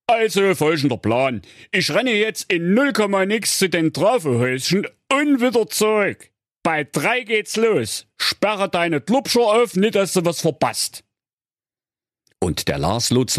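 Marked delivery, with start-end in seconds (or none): 0.1-11.0 high, fast cheeky voice; cheeky hero voice. Acted. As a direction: rattle it off cheekily. Lars explains plan.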